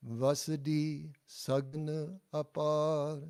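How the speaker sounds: slow, clear call for the class to repeat; slow model phrase. Shown as a demonstration. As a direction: model Vasadi Sagan Apar slowly for students to echo. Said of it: "And again".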